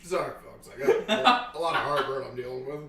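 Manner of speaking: words broken by burps; burping. Real, not acted. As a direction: burp through the words. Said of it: "I did the burping bit".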